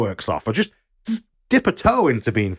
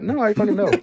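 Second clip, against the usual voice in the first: higher-pitched, incredulous refusal; mock-outraged no; indignant refusal; 10 semitones higher than usual. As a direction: refuse as if the offer is absurd. Imagined refusal of a dangerous offer.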